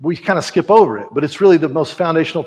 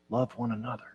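soft, faint, much quieter; repeated whisper; 3 semitones lower, 13 dB quieter than usual. Retold or imitated. Preacher voicing old John's repeated whisper. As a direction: breathe it once more, gently. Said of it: "all he could do was whisper".

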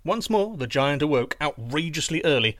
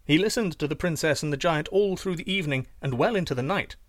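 ordinary delivery, nothing marked

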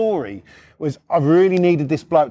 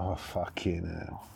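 low, soft, slow groan of dismay; retold under-the-breath despair; dismayed aside; 11 semitones lower, 16 dB quieter, slower than usual. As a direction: mutter it to yourself, sinking. Retold private dismay at the recruit.

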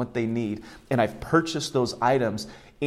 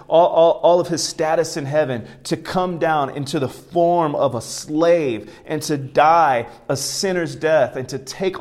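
rising, louder sermon climax; preaching at full force; 4 semitones higher, 5 dB louder than usual. Acted. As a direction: build the sermon to a loud peak.

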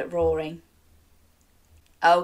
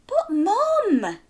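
put-on protesting boy; 12 semitones higher than usual. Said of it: "Jack protested".

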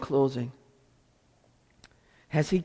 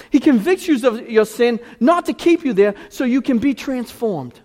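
loud, raised-pitch pleading prayer; impassioned preaching; 9 semitones higher, 9 dB louder than usual. Real, not acted. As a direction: cry out to God with full force. Preaches at full force.

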